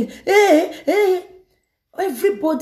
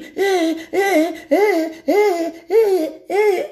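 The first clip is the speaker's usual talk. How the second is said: high trembling eh eh of a shaking woman; imitating a frightened woman shaking; mimicking her shaking; 8 semitones higher, much slower than usual. Shown as a demonstration. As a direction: act out the trembling woman with shaky high cries. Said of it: "This is what she was".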